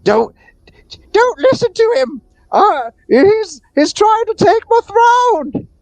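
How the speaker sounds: very high squeaky falsetto cat; high-pitched cat protest; yelling cat. Acted. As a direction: cry out as an indignant cartoon cat. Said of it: "the other cat yells out".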